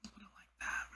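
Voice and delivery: ordinary delivery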